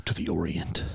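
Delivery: mock-exotic put-on voice, trailing low. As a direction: say it in a hammed-up, mysterious voice. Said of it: "say it in that voice".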